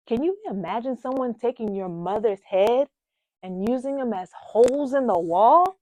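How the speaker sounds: loud, high, incredulous question; outraged disbelief. Acted. Daughter asks listener to imagine her mother's abuse, appalled and loud. Recounts mother's head banged into wall.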